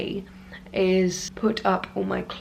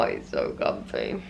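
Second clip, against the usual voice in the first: breathy, drowsy whisper; sleepy sigh; 4 dB quieter than usual. Real, not acted. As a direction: sigh it out, sinking into bed. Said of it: "but this bed is so comfy".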